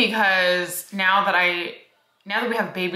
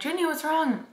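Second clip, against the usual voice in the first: put-on higher, softer mom voice to a crying child; gentle concern; 9 semitones higher, 4 dB quieter than usual. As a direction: coax a crying daughter softly. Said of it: "I'm like".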